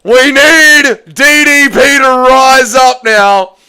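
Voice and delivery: rally the crowd for DDP; fired-up shout. Real, not acted. Cheering his pick after a knockout.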